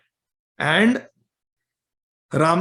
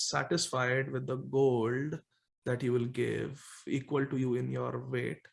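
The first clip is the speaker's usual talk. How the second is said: low, soft, trailing concession; resigned acceptance; 5 semitones lower, 10 dB quieter than usual. Acted. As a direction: give in reluctantly, sinking low and quiet. Brahmins give in reluctantly.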